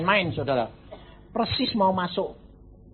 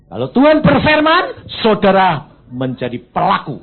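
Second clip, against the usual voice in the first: loud, high exhortation; booming pulpit exhortation; preaching at full force; 4 semitones higher, 10 dB louder than usual. Real, not acted. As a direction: call the congregation to act.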